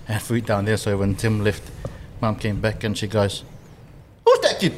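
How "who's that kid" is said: high-pitched mock questioning mum; imitating mum. Mum asks son about his guest, high imitated voice.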